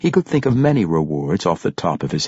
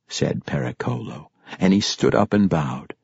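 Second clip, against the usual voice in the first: plain reading voice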